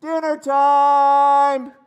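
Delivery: high, loud, drawn-out holler; long-distance singsong yell for dinner; mom calling out. Retold or imitated. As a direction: call the kids home from across the park. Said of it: "we would hear in the distance".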